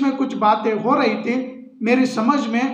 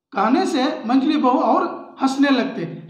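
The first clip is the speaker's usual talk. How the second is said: plain reading voice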